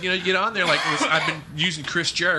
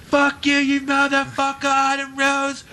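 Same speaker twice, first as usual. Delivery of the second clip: put-on high, raging British boy voice; high mock British brat cursing; mimicking an English kid; 8 semitones higher than usual. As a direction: squeal it like a furious nine-year-old English boy. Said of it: "get my voice up that high to mimic a nine-year-old boy from England".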